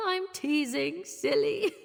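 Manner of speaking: playful giggle. Acted. Playful teasing laugh.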